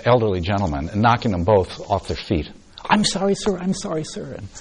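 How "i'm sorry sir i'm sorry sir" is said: higher-pitched, hurried apology; voiced girl's anxious apology; flustered young girl. Girl apologizing after knocking man over.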